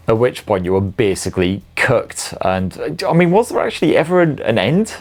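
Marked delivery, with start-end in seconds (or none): 3.1-4.9 high, fast disbelieving question; incredulous. Real, not acted. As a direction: ask it in sheer disbelief.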